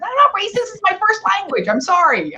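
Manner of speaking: high-pitched mock defensiveness; retold indignant protest. Retold protest to scolding daughter.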